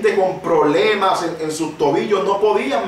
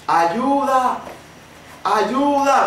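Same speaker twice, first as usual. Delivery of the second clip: retold cry for help; 6 semitones higher, much slower than usual. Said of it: "comenzamos a gritar".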